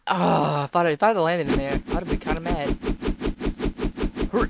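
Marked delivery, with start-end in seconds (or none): none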